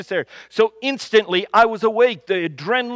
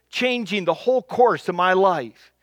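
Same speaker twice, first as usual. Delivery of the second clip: ordinary delivery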